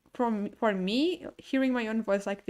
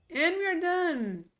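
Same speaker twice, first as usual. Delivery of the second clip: excited announcement; 7 semitones higher, 3 dB louder, slower than usual. Real, not acted. Cheerful end of quest.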